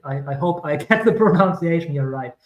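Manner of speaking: ordinary talk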